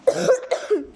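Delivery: hacking chesty cough; real cough mid-video. Real, not acted. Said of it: "Sorry za kaszel".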